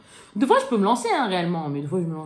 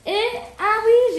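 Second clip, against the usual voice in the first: sudden recall; 11 semitones higher, 6 dB louder, slower than usual. Real, not acted. Suddenly remembers another item.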